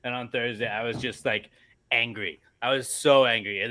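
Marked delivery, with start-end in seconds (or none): none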